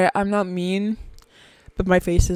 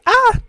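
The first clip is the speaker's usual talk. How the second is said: sudden high loud scream; quick high playful scream; demo scream; 6 dB louder than usual. Shown as a demonstration. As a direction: let out a short shrill ah. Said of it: "'Cause I scream".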